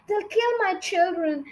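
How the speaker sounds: high-pitched mother's cry; distressed cry. A narrator's reading. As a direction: cry out in fear for the children. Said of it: "cried Missus Fox".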